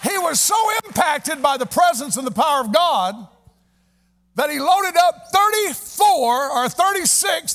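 high, loud preacher's shouted climax; shouted revival preaching, slow on the calls; preaching at full force. Acted. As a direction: whip the congregation into praise. Preaching climax, calling for praise.

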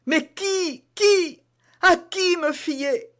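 very high, desperate cries, slowing at the end; a trapped queen with no one to trust; panicked queen. Acted. Reine désespérée. (reine paniquée, voix très aiguë)

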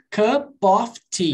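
"Cup of tea" stretched out slowly; slow demo. Shown as a demonstration. Teacher slowly models "Cup of tea" for a confused student. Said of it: "Ó".

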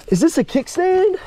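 P=B; excited question. Real, not acted. Spots a possible kickstand.

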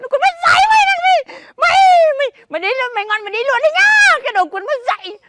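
mother screaming at her ungrateful son; furious shout. Acted. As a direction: rage at the kid and throw him out. Mother scolds son, kicks him out.

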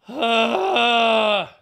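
long, loud high exasperated groan. Real, not acted. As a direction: groan it out at full voice.

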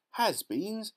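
puzzled by the odd word; surprised cry. A narrator's reading. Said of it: "cried Violet Beauregarde".

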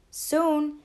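ordinary delivery

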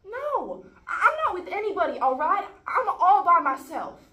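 high, very loud denial; exasperated shout. Acted. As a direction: shout it back, fed up. Exasperated denial of taking sides.

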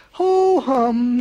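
loud, high exaggerated yawn. A narrator's reading. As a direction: yawn the words out, bored. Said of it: "he yawned a great big yawn".

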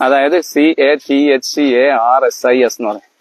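slow, letter-by-letter spelling of catharsis. Shown as a demonstration. Teacher spells the word for students, slow dictation. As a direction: spell each letter slowly, one by one, for the class. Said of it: "spells catharsis letter by letter".

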